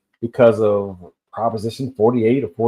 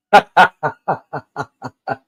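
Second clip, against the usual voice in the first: short real laugh, higher pitched; 4 semitones higher, 3 dB louder than usual. Real, not acted. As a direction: break into a laugh at your own joke. Laughs at his own joke.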